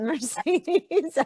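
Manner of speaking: laughing through the name, high. Real, not acted. Laughing as she spots Mercedes.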